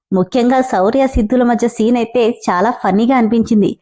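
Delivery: usual speaking voice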